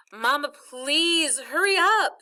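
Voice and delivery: loud, high, drawn-out begging; urgent plea. A narrator's reading. Child begging mother to come rescue brother, urgent plea. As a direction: plead with rising urgency.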